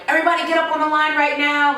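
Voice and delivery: high, fast barked command; drill instructor order. Retold or imitated. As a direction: bark it at the recruits. Retelling instructor ordering recruits.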